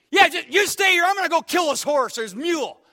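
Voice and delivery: loud high mock-angry shout; faked anger. Ballplayer faking rage at his buddy, shouting. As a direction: bark orders while playing mad. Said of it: "faking anger".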